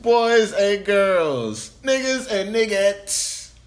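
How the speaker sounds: slow booming host welcome; grand entrance announcement. Acted. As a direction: boom it out like a ring announcer. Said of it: "Can I start like that?".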